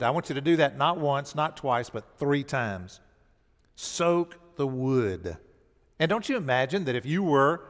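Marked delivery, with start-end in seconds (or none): 3.8-5.4 soak the wood, drawn out very slowly; slow, deliberate order; slow command. Retold or imitated. Elijah instructing the prophets, slow and deliberate. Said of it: "he instructs the prophets".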